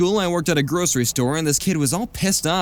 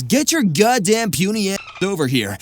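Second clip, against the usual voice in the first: raised-voice mock scream from a teacher; higher, hammed-up angry order; 4 semitones higher, 3 dB louder than usual. A narrator's reading. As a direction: act out a teacher yelling at students. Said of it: "the teacher just screams".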